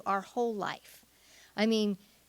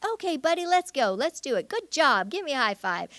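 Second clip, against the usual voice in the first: put-on high, upbeat encouragement to a child; playful Karen Purvis; 7 semitones higher, 4 dB louder than usual. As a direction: cheer a little one on, light and bouncy. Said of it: "How do I communicate through my voice".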